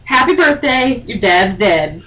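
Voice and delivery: ordinary delivery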